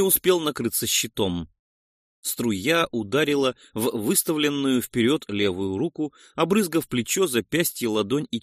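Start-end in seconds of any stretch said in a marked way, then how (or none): none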